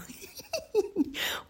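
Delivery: high-pitched giggle; laughing. Real, not acted. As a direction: laugh at yourself in a high titter. Laughs at her own example.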